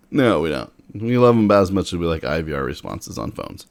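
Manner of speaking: ordinary talk, nothing marked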